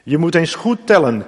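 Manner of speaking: ordinary delivery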